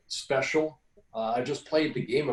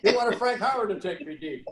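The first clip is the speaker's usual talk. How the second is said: laughing; 8 semitones higher than usual. Real, not acted. Still laughing at the story.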